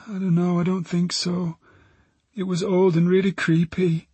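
put-on high, unsure girl's voice; young witness, pitched high; frightened teenage girl. Girl answers detective about the building, unsure.

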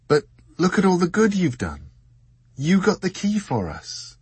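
Dor, comforting. A narrator's reading. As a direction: lift him up with kindness. Narrator voices Dor comforting.

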